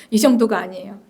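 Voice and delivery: ordinary talk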